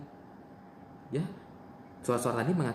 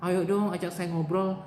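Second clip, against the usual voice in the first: pleading patient; 6 semitones higher than usual. Shown as a demonstration. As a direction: beg a roommate to talk with you. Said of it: "saya praktekkan ya".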